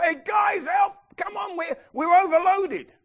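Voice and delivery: high, loud, urgent shout; panicked call for help. Retold or imitated. Fishermen shouting to James and John, overloaded panic. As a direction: yell to the other boat for help.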